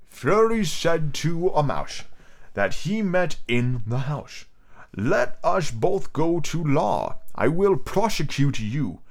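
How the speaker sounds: slow, drawn-out storybook mouse voice; mouse reciting verse. A narrator's reading. The mouse tells Alice its tale, slow put-on recital. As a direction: recite it slowly, line by line, in a put-on voice.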